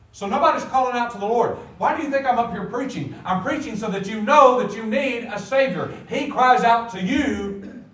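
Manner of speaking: loud, high, urgent preacher; full-force preaching. Real, not acted. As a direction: preach it out to the whole congregation.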